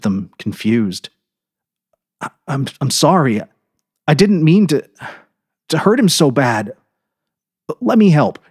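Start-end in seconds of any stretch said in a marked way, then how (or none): 2.2-8.4 stammering apology. A narrator's reading.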